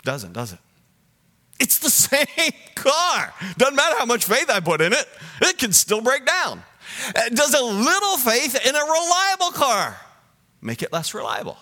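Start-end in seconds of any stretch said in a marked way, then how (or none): 1.6-10.0 high, urgent pulpit voice; raised, insistent sermon delivery; forceful preaching. Real, not acted. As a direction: drive the argument home in a high preaching voice.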